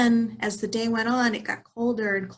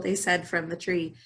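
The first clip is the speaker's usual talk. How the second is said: ordinary delivery, nothing marked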